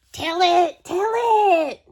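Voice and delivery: high, loud eager squeal; excited begging. Acted. Piggy begs Gerald to tell the story, high and loud. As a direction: beg for the story.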